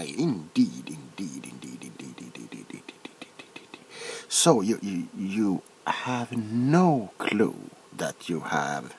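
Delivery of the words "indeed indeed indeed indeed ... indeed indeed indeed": repeated indeed fading to a whisper. Acted. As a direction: let each indeed drop softer until it's only breath. Doctor trails off, repeating indeed.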